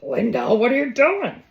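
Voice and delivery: high, loud mock-exasperated call; hammed-up telling-off, pitch swooping up; mock scolding. Cook playfully scolding Linda, high mock exasperation.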